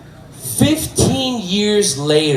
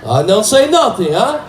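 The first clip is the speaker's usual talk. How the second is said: teasing João; 6 semitones higher, slower than usual. Acted. João teasing him about keeping a secret, high and teasing. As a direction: tease with a high rising "huh?".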